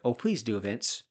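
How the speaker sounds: ordinary talk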